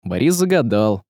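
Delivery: usual speaking voice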